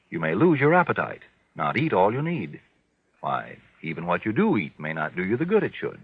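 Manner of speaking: ordinary talk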